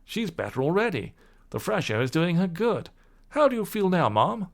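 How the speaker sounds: kindly gentleman. Acted. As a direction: ask after her with care. Gentleman voiced in story.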